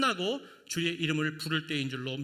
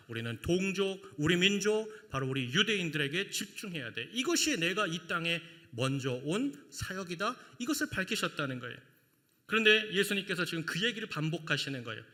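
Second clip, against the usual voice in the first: ordinary talk, nothing marked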